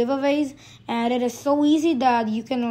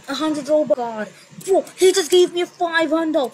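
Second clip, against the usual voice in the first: excited; 4 semitones higher, slower than usual. Retold or imitated. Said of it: "let me just show you".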